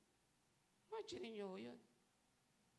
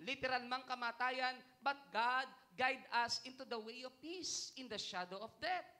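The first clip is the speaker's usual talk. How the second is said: preaching at full force; 7 semitones higher, 7 dB louder than usual. Acted. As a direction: proclaim the verse to the whole congregation. (malakas at madiing pangangaral)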